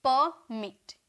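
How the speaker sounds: "परमिट" split as पर, pause, मिट; two separate syllables. Shown as a demonstration. Teacher showing two syllables in "परमिट", with a gap between them. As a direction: sound out both beats of "परमिट". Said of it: "दो sound निकल रहा".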